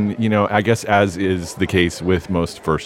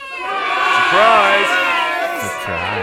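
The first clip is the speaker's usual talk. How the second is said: surprise party shout; much slower than usual. Acted. Surprise party sprung on host.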